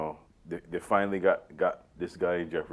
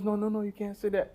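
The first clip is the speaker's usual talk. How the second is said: high-pitched mock correction; Larry; 12 semitones higher, 4 dB quieter than usual. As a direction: play the fussy sitcom cousin cutting in. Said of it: "And Larry goes to".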